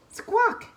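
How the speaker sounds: high, loud bird squawk; gull squawk. Acted.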